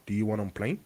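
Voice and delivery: soft, low drive-thru worker asking about the order; whispered drive-thru order. Retold or imitated. Imitating the drive-thru worker taking his order, softly whispered. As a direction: hush it like the taco guy reading back the order. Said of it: "I just said it in a whisper".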